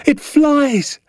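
put-on very high, thrilled child's shout; boy's squeal of delight; jubilant shout. A narrator's reading. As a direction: shout with joy, voice shooting right up. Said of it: "I shouted".

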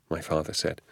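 plain reading voice

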